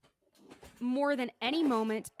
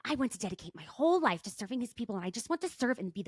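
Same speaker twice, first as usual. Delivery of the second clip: a hint of a gushing younger self, higher; earnest teen diary; 5 semitones higher than usual. Host reading her teenage journal aloud, mock earnest. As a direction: read your old devotion with mock zeal. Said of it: "I've read my journal".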